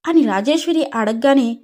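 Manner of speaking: plain reading voice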